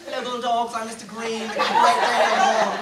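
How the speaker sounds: playful Jamaican accent impression, ending loud; Mister Green impression. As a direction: do the teacher's Jamaican accent as a morning announcement. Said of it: "let me just get into character".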